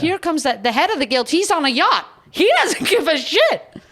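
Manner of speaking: high, loud indignant tirade; worked-up outrage about the guild head; heated rant. Real, not acted. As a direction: rail against the absent boss.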